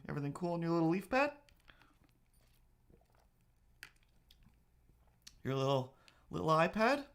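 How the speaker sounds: high, quick cooing at the cat. Real, not acted. Baby talk to the cat.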